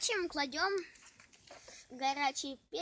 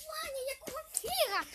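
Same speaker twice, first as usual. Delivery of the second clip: alarmed; 11 semitones higher than usual. Real, not acted. Sister alarmed at fire.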